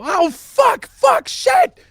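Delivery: high, loud panicked shout; Corporal Macron panicking. Acted. Game master voices a marine scrambling to climb, panicked shout. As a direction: yell out curses in full-blown alarm. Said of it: "you hear Corporal Macron go".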